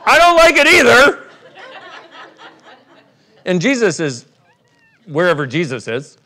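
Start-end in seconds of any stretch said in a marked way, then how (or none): 0.1-1.2 high, loud mock yell; comic outburst. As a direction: burst out in exaggerated protest.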